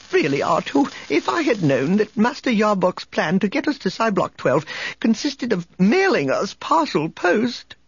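high, prissy complaining droid; fussy protocol droid. A narrator's reading. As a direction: whine it like a fussy robot butler. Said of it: "a muffled voice complained".

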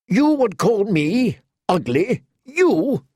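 high, slow, outraged retort; enraged. A narrator's reading. Insulted knight rounding on Tyrion, high and enraged. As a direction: spit it back in disbelief. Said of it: "Sir Boros went purple with rage".

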